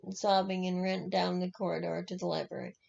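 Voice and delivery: ordinary delivery, nothing marked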